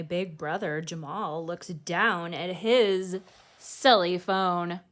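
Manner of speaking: put-on lively read-aloud voice for children; storybook reading. A narrator's reading. Host reading a picture book to kids, lively storytelling. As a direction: read it like bedtime story time.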